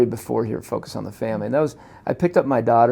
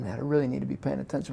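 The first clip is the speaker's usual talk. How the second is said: hurried inner thought; 8 dB quieter, much faster than usual. Retold or imitated. Inner thought while distracted.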